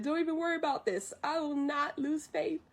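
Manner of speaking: high-pitched retold promise; mock assurance. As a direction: reassure the boss in a lightly acted high voice. Mushu assures the leader.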